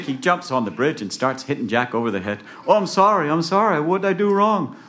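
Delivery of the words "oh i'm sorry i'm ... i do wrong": high, loud comic pleading; frantic apology. Acted. Jack begging the man hitting him, high frantic apology. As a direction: plead like someone being hit.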